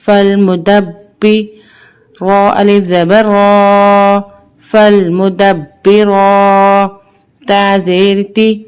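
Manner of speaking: slow, measured chant with long held vowels; Qur'anic spelling recitation. Shown as a demonstration. Teacher spelling verses for learners, measured chant. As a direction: intone each letter, then blend it.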